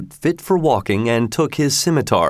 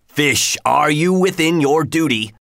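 menacing slave voice, slightly raised; terrible voice; 4 semitones higher than usual. A narrator's reading. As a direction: loom over the pan and demand. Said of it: "In a terrible voice".

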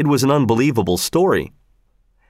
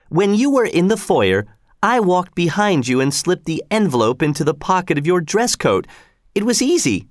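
higher woman's voice, matter-of-fact confession; Missus Giry; 6 semitones higher than usual. A narrator's reading. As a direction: explain the trick, pitched up as the woman.

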